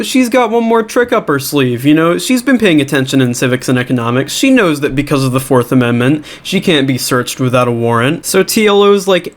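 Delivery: ordinary talk, nothing marked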